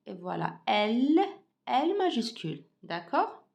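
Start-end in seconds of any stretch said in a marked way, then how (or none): none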